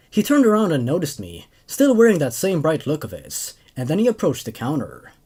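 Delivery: even first-person narration; story narration. A narrator's reading. Narrator telling of returning Marine, in even narration. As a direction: read it like a calm soldier's recollection. Reads second story aloud.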